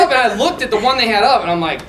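normal talking voice

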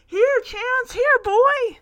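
high, loud calling voice; calling the dog. Retold or imitated. Jamie calls Chance out of the woods, loud calling. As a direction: call out to a far-off dog. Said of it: "Jamie continues calling".

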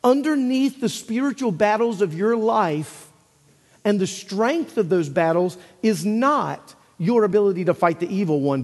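ordinary talk, nothing marked

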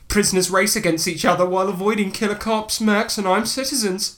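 high-pitched, hurried read-out; odd reading voice. Real, not acted. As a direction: let the pitch climb oddly as you rattle it off. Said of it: "I don't know why I'm saying it like that".

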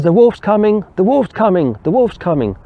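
high, repeated alarm call; storyteller's high-pitched cry, easing down slightly with each repeat; boy crying wolf. Acted. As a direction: act out a boy calling a warning over and over. Boy cries wolf as it comes.

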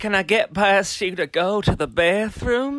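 drawn-out mocking impression; slow put-on voice. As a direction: drag each word out slowly. Voicing Pandora in confessional.

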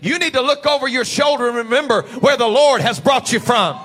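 usual speaking voice

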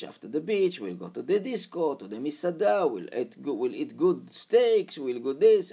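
loud, high mocking Israeli driver; mocking taxi driver. Cab driver taunts the religious student, loud and high. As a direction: scoff at him, selling the good life hard.

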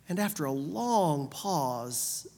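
normal talking voice

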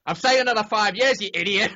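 high, loud retold punchline; mock exasperated shout. Howard revealing the prank to Sal, mock exasperated shout. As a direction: burst out with the reveal, loudly.

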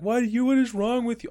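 high, loud outraged wail; mock scream. Tased person protesting to the cop, high mock scream. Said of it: "And then they scream".

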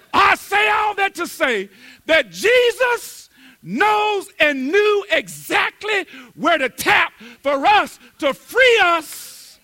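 high, loud, slow preaching climax; full-force preaching. Real, not acted. Preacher hammering the point home to the congregation. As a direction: drive the point home to the church.

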